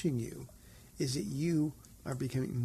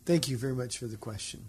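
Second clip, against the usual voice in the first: ordinary talk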